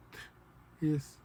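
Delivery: ordinary talk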